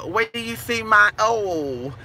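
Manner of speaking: usual speaking voice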